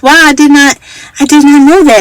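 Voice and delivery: put-on older lady, amazed.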